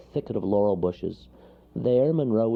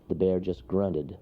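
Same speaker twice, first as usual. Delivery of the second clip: ordinary delivery, nothing marked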